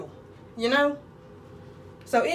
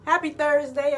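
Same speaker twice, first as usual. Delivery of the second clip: loud greeting; 3 semitones higher, 5 dB louder than usual. Real, not acted. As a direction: belt the greeting like a morning host. Vlog greeting sung out loud.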